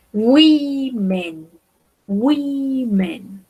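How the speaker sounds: "women" drawn out slowly as WEE-men; teacher modelling "women" in two long syllables; pronunciation demo. Shown as a demonstration. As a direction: stretch the first vowel of "women" into a long "wee". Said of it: "Recuerden la pronunciación".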